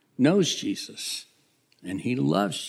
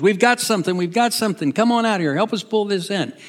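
high, loud call to the bank; acted shout across the water; excited hollering; 9 semitones higher, 6 dB louder, faster than usual. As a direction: holler urgently for help hauling it in. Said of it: "He hollers at James and John".

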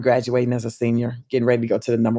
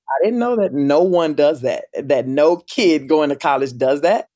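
usual speaking voice

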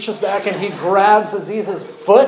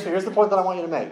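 usual speaking voice

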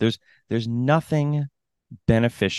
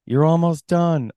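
higher, louder mock call; mock cheer; 7 semitones higher, 5 dB louder than usual. Spectator to runner who knows what's going on, mock cheering.

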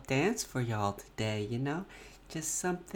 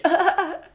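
burst of laughter; 8 dB louder than usual. Real, not acted. Laughs after the Italian bit.

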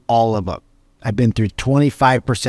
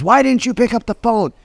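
mock angry client; 9 semitones higher than usual. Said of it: "a client that calls and says".

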